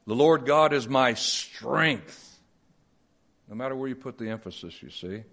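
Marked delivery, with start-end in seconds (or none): 0.1-2.4 slow reading with Lord emphasized; stress on Lord. Shown as a demonstration. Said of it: "shift the emphasis in different places".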